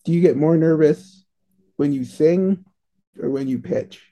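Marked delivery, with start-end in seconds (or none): none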